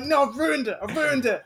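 lightly hammed-up dismay; mock dismay. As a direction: fret that it's ruined. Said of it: "in my head, I'm like".

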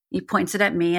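ordinary talk

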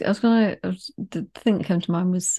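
ordinary talk

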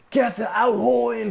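high, loud barked order; mock skipper shout. Skipper yelling orders at the crew in a storm, loud mock shout. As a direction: yell the order like the skipper over the wind.

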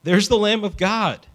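high, loud proclaiming cry; excited declaration. Acted. John the Baptist pointing Jesus out to his disciples, excited cry. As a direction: announce him like you can't believe it. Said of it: "John looked at him and declared".

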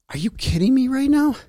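high, disbelieving cry; incredulous. Retold or imitated. A listener reacting to Grammys binge, high and incredulous. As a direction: pitch it up in disbelief. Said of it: "And you're like".

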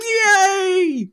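high, loud frog cheer; a squealing puppet yay; Kermit cheering. Acted. Kermit cheering for the hosts, very high and loud. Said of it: "I can do Kermit".

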